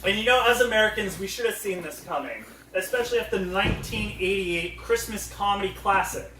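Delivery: normal talking voice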